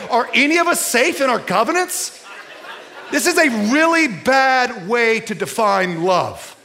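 high, loud, laughing challenge to the crowd; preaching loud. Acted. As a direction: push them hard with a laugh, voice up high.

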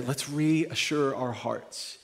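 slow, soft, every word weighed; slow gentle emphasis. Shown as a demonstration. Pastor easing his congregation, slow and soft. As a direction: lean gently on reassure. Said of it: "the word I really wanna focus on".

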